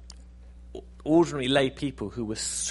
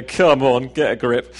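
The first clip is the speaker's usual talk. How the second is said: loud scoff; 11 dB louder than usual. Acted. Friend scoffing at your score.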